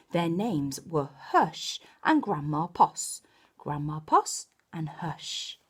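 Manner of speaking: lively storybook reading; reading to children. Acted. Storyteller reading Possum Magic to young children, animated. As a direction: bring the picture book to life for little ones.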